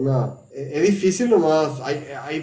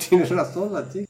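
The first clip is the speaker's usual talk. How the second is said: imitating Anna's accent; 3 semitones higher than usual. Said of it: "Y el acento, qué bien que le sale".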